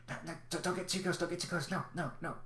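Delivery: high, soft, hurried creature voice; nervous little creature keeping its voice down; hushed whispering. Acted. Game master voices scurrying creatures bargaining over food, hushed and high. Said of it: "like, whispers behind you".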